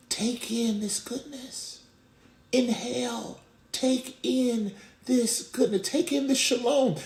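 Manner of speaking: high, drawn-out, slow coaxing; God's invitation. Acted. God inviting listeners to inhale his goodness, slow and high. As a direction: stretch each phrase slowly, voice lifted. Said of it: "God is saying".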